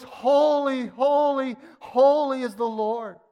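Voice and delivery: loud, high "Holy, holy, holy"; exalted proclaiming of "Holy"; angels' proclamation. Retold or imitated. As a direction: ring out "Holy" like angels declaring. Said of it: "it was".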